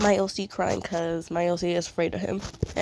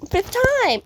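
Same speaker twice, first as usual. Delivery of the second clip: much higher and louder, laughing at herself; exasperated squeal; 14 semitones higher, 5 dB louder than usual. Real, not acted. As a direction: squeal it in mock despair. Finds she redid her character again.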